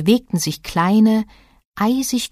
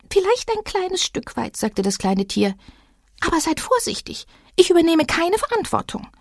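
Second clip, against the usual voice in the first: much higher, uneasy animal voice; scared little creature; 11 semitones higher than usual. A narrator's reading. Little animal refusing to enter the moor, high and uneasy. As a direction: shrink back from the moor.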